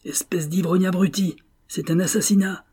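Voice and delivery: high, urgent cry of outrage; yelled. Acted. Purvis yelling at drunken Rosenthal, alarmed cry. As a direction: shout him down in alarm. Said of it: "hurla Purvis".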